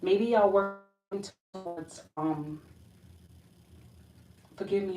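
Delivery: ordinary talk, nothing marked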